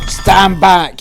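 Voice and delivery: loud, high commanding yell; police shout. Retold or imitated. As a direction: bark it at the door like a cop. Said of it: "he said".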